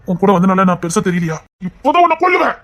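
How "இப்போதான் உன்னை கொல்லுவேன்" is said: angry shout. Acted. Angry shout at his oldest friend. Pleading angrily with lifelong friend.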